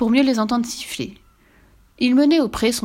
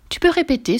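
high-pitched pleading question; pleading mother; 9 semitones higher, 4 dB louder than usual. A narrator's reading. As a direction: beg to hear it once more. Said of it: "supplia la mère". (mère suppliante)

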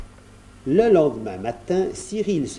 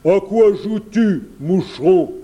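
loud, raised bear voice; growling bear; 6 semitones higher, 6 dB louder than usual. A narrator's reading. Bear questions the small boy, growling. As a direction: challenge the little intruder as a gruff bear. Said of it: "grogna l'ours".

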